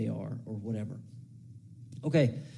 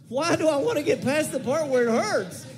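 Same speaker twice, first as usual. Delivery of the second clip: high, loud incredulous outburst; exasperated disbelief; 11 semitones higher, 5 dB louder than usual. Acted. To Levi about energy drinks, loud incredulous disbelief. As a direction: throw the question back, baffled and loud. Incredulous retort to friend's advice.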